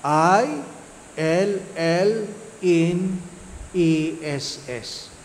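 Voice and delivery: illness spelled "I L L N E S S" letter by letter. Shown as a demonstration. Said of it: "think of the word illness". (binabaybay ang "I L L N E S S" nang dahan-dahan, titik por titik)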